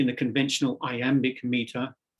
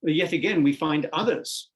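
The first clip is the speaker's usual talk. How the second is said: ordinary talk, nothing marked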